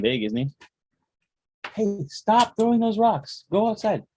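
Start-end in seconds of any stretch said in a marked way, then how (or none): 1.7-4.0 stern scolding. Real, not acted. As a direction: stop the rock throwing. Father scolds child throwing rocks.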